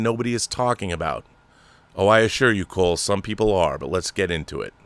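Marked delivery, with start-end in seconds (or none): none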